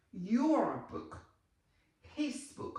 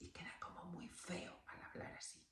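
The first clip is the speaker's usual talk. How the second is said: whispered aside about bastante bueno and muy feo; confiding whisper to the class; 14 dB quieter, faster than usual. Real, not acted. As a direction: lean in and whisper it like a secret. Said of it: "confiding aside to students".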